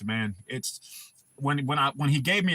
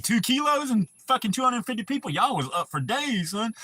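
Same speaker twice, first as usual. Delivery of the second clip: higher, amused disbelief at the numbers; excited exclaim; 10 semitones higher, 3 dB louder than usual. Retold or imitated. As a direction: blurt it out, half laughing, impressed. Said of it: "I was like".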